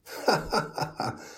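high burst of mocking laughter; loud laughter. A narrator's reading. The crowd laughs at God, mocking and loud. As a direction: everyone roars with laughter. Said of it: "Lachte iedereen hard".

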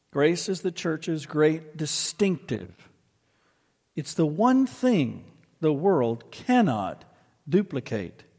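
slow, weighty read-aloud with swelling stresses; emphatic sermon reading. Acted. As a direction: read it out slowly, leaning on key words. Reads quoted passage with slow emphasis.

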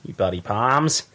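American accent slipping towards Australian; attempted American accent. Shown as a demonstration. Trying out "Poms" for co-hosts, botched American accent. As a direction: have a shaky go at an American accent. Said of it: "sound like in an American accent".

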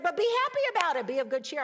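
high, loud, rapid exhortation; emphatic cheerful urging. Retold or imitated. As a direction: cheer them on at the top of the voice. Quotes Jesus urging cheer.

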